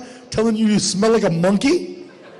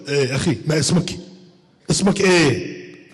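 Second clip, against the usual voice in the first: put-on Arabic accent; 5 semitones lower than usual. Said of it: "That's what they say".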